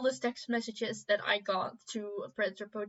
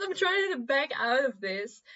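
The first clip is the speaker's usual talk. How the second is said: talking through laughter, a bit louder; exasperated laughing words; laughing exasperation; 3 semitones higher, 4 dB louder than usual. Retold or imitated. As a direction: laugh it out in disbelief. Exasperated, laughing while retelling.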